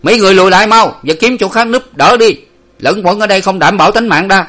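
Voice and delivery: high, forceful shouted order; loud, brusque sentry's yell. A narrator's reading. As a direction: bawl at them to fall back. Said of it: "càng quát to thêm".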